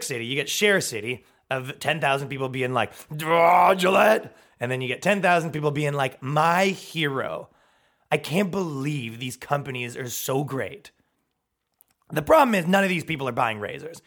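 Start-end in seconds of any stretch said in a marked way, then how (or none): 3.1-4.4 loud, dopey roar of complaint; mock outrage. Ten thousand people raging online, mock roar. Acted angry crowd. 6.2-10.9 slow, drawn-out gushing; mock adoration. Ten thousand fans praising a company, mock adoring.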